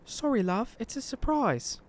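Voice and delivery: high, soft English granny voice; gently apologetic English old lady; soft English accent, pitched high. Acted. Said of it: "She's originally from England".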